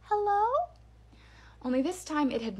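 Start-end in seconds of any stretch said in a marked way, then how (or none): 0.0-0.5 put-on high, louder little-girl doll call; eerie doll voice calling from closer; much higher creepy little girl. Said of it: "the voice said again".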